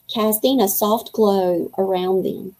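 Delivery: ordinary delivery, nothing marked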